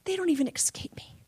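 hushed whisper. Acted. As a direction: breathe it as God's tender promise. Quoting God gently.

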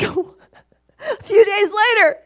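laughing it out, high and slow. Real, not acted. Laughing while telling the church about a few days later. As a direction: let the laugh carry the words.

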